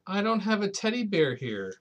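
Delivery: put-on high, small child's plea; a little boy protesting to granny; small boy pleading. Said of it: "said Patrick".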